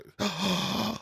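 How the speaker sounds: a high startled gasp; real surprised intake of breath; gasping on discovering he's featured; sharp gasp. Real, not acted. Learning he's in the book.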